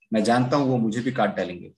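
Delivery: ordinary delivery, nothing marked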